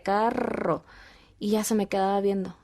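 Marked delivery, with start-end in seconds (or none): 0.0-0.8 "carro" with a long vibrating R; rolled R. Shown as a demonstration. Mother shows her tongue, "carro" with rolled R. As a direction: trill the rr in "carro" for a learner. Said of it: "cuando pronuncio la R".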